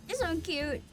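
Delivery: ordinary talk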